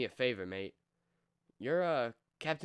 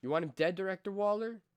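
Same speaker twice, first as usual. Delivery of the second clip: ordinary talk, nothing marked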